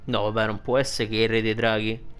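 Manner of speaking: usual speaking voice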